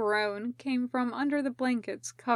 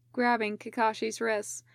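usual speaking voice

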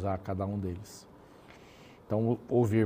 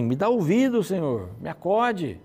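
high, loud cry for help; a pleading shout, slowing on acode; desperate plea; 6 semitones higher, 5 dB louder than usual. Pretend. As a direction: beg the Lord to listen. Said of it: "Primeiro ele diz assim".

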